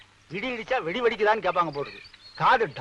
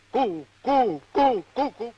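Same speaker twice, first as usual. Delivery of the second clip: stammer, stuck cooing on one syllable; stuttering coo; much slower than usual. Acted. Matchmaker trying to flatter the parents, stuttering on "koo". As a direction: get stuck hooting the same syllable. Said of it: "ஏன் இப்படி கூவுற".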